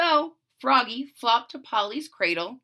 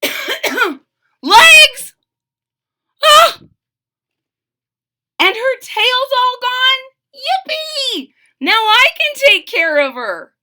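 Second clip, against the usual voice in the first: put-on much higher, loud gleeful kid voice; excited shout; 13 dB louder, slower than usual. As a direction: burst with joy at the news. Froggy sees baby's legs.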